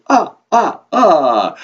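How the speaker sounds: loud, high staccato vampire laugh, falling; triumphant "ah, ah, ah" cackle; Count. Acted. Count delighted with his counting, booming vampire laugh. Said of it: "laugh after everything you say".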